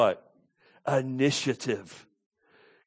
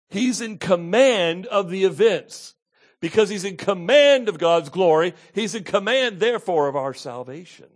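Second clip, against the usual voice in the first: raised, loud and insistent sermon voice; forceful preaching; 5 semitones higher, 8 dB louder than usual. Acted. Preacher declaring Christ in command of events, forceful preaching. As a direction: drive home each repeated claim.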